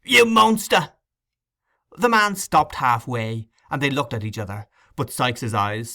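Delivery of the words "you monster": high, loud cry of horror; a boy's appalled outburst; horrified cry. A narrator's reading. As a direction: fling it at him in disgust. Boy accuses the murderer.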